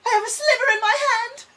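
high, fast, loud whine; mock panicked cry over a sliver; hammed-up wail. Sudden fake injury cry.

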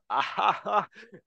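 hearty high laugh, imitating Klopp. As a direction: do a big booming Klopp chuckle. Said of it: "Trying to do his laugh".

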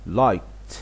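liked as one syllable ending in t; t-sound ending. Shown as a demonstration. Teacher demonstrating the ending of liked for learners. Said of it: "نسمع كده".

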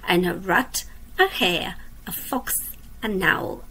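lively storybook reading for little ones; reading to children. A narrator's reading. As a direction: read it like a bedtime picture book. Reads a picture book aloud.

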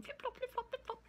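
high squeaky sing-song flip-flop; playful high-pitched sound effect. Acted. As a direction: pop the sound way up high like a tiny tail. Squirrel's tail sound effect.

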